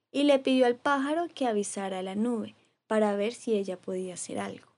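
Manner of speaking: ordinary delivery, nothing marked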